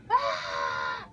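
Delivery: loud crying yell of pain; pained scream. Retold or imitated. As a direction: scream as if you just got burned. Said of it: "And he screams in spa-, in pain".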